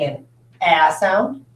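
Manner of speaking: "a" as the short vowel in "match"; short A sound. Shown as a demonstration. Teacher offering students vowel choices, short A. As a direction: say "a" as a short, open A. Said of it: "It's making its first sound".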